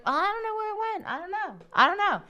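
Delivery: put-on squeaky clueless teen whining; ditzy daughter voice. Mimics the daughter who loses things.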